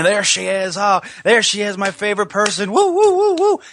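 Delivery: mock scream, high-pitched, breaking into shrill whoops; excited wrestler's holler with whooping; Ric Flair screaming. Host imitating a wrestler spotting his favorite person, mock screaming. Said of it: "he starts screaming".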